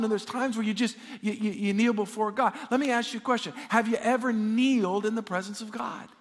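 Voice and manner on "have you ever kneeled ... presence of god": ordinary talk, nothing marked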